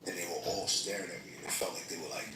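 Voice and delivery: ordinary delivery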